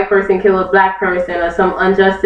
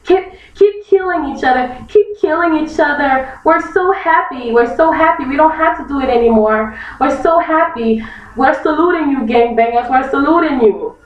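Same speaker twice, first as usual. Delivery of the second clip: mock KKK gratitude; 3 semitones higher than usual. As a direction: play a smug Klansman thanking gangbangers.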